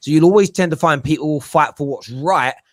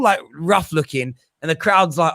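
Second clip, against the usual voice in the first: ordinary talk, nothing marked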